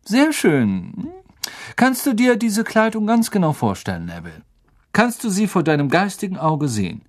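a friendly professor coaxing a shy pupil; Professor Lupin voice. Acted.

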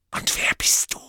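cold whispered hiss; hissing Parseltongue. Acted. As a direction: hiss the question. Riddle in Parseltongue.

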